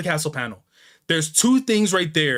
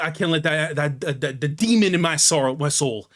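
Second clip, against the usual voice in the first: normal talking voice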